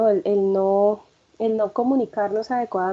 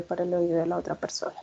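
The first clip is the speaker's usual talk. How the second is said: fast, softer whisper to show too-quiet speech; barely audible whisper; 4 semitones lower, 8 dB quieter, faster than usual. Shown as a demonstration. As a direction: breathe it almost inaudibly, fast. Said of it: "hablar demasiado bajo que sea casi imperceptible". (susurro casi imperceptible)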